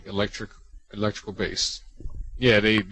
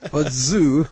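laughing hint of a French accent; 5 semitones higher, 7 dB louder than usual. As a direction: say "Part two" the French way, light. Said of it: "That was the best French accent ever".

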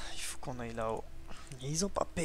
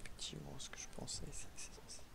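hushed unvoiced whisper while sneaking; 10 dB quieter, faster than usual. Real, not acted. Sneaking past guards in a forbidden zone, whispering. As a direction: whisper so the guards won't hear.

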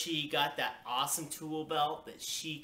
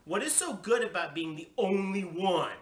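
higher, louder indignant question; snapping; 5 semitones higher, 5 dB louder than usual. A narrator's reading. Child challenges parents, snapping back. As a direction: snap it, leaning on "only one". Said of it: "very snapping".

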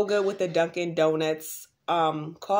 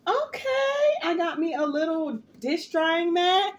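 excited high squeal; 9 semitones higher, slower than usual. Real, not acted.

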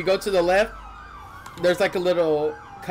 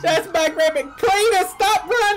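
high, loud shriek at the fleeing thief; screaming; 11 semitones higher, 3 dB louder than usual. Acted. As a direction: yell at Cletus to stop. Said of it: "I've got you screaming at me".

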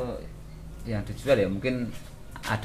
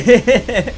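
loud, high burst of laughter; 11 semitones higher, 11 dB louder than usual. Real, not acted. Laughs about father's favourite goat. (tertawa lepas, keras dan tinggi)

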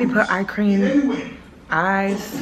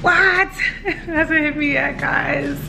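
nervous laugh; 7 semitones higher, slower than usual. Real, not acted. Surgery hasn't hit her yet.

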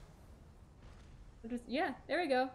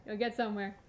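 ordinary talk

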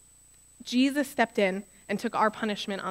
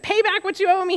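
loud, high, angry demand for payment; 9 semitones higher, 5 dB louder than usual. A narrator's reading. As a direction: bark the demand at the debtor. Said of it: "he demanded".

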